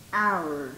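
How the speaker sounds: "hour" dropped low, beginning on the vowel; silent H. Shown as a demonstration. Teacher repeats the example, low "hour" with silent H. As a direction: let "hour" fall low, pronounced "our". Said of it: "Because the H is silent".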